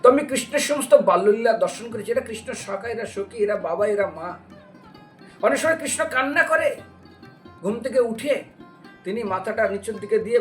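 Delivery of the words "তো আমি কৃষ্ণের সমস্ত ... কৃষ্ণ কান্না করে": ordinary talk, nothing marked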